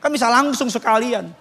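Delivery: normal talking voice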